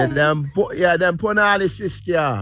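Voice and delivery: slow, light Jamaican patois; a hint of Jamaican patois; Jamaican accent. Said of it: "that ain't Jamaican sounding".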